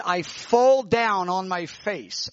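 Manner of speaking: normal talking voice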